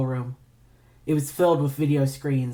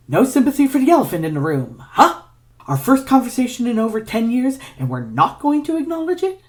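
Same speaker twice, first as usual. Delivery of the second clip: agitated outburst; 10 semitones higher, 5 dB louder than usual. Acted. Ignatius confronting ex about breakup.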